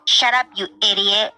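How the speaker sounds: put-on slow, sneering kid voice; five-year-old being rude to a cop; bratty. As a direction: spit it out like a rude little brat. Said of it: "I was just being a brat".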